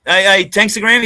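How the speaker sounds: loud call for attention. Real, not acted. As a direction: call the name out across the room. Calling out to a listener.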